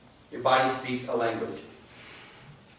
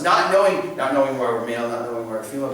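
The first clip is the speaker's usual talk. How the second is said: ordinary talk, nothing marked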